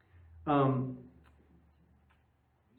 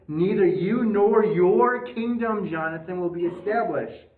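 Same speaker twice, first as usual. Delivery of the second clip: louder Saul warning; 4 semitones higher, 5 dB louder than usual. Retold or imitated. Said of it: "verse thirty-one says everything".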